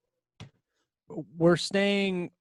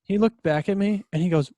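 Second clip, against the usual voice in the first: usual speaking voice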